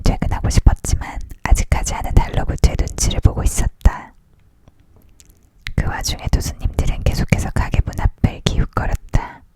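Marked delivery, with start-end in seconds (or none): none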